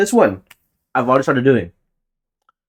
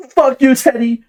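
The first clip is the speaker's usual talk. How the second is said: high, loud mock producer-tag shout; hyped-up producer tag blasted at the start of a track; 14 semitones higher, 8 dB louder than usual. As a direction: yell it like a beat's signature drop. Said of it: "my tag is gonna be like".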